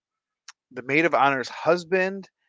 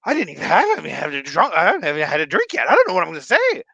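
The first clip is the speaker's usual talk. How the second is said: higher, fast, panicky rush; panicking brother; 5 semitones higher, 4 dB louder, faster than usual. Retold or imitated. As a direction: blurt it out in a rising panic. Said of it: "looks at me and goes".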